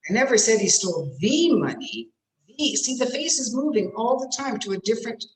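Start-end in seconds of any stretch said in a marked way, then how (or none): 0.1-2.1 emphatic stretched "thee" before money; stress on the. Shown as a demonstration. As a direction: lean hard and long on "the". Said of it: "put stress on a different word".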